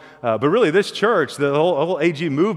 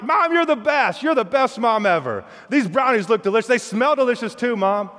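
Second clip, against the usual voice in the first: put-on much higher, gushing kid praise; over-the-top thanks, pitched up; gushing boys; 6 semitones higher than usual.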